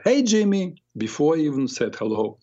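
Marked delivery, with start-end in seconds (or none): 0.0-0.8 put-on high, bright kid's greeting; man imitating a child calling for Jimmy; child's voice. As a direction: voice a young boy calling his friend. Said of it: "a child's voice said".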